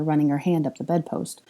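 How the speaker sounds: usual speaking voice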